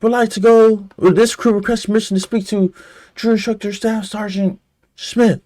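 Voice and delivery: barked sir yes sir. Retold or imitated. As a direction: sound off like a boot recruit. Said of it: "You like".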